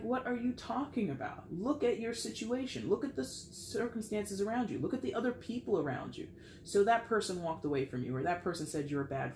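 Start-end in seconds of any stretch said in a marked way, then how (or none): 0.0-6.3 higher, incredulous push to look around; exasperated pleading. Retold or imitated. Friend urging a moping friend, exasperated. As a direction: coax a sulking friend to see what they still have. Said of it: "It's just like".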